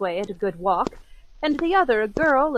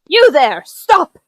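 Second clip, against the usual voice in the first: commanding shout; 8 dB louder than usual. Acted. Enfield calls out to the man who trampled the girl, shouting. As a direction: bark out a challenge across the street.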